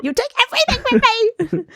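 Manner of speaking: high-pitched mock wail; hammed-up outburst breaking into laughter; Melly. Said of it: "in the words of Melly from".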